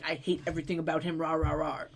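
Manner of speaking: low, fast dismissive grumble; mock hater rant.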